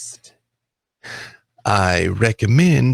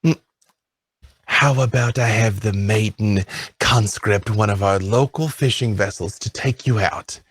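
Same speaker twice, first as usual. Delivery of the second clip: ordinary talk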